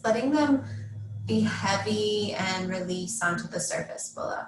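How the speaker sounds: measured meditation guide, long pauses between phrases; guided meditation. Real, not acted. Yoga teacher settling students into Yoga Nidra, guided meditation. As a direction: guide them gently into rest, leaving space after each instruction.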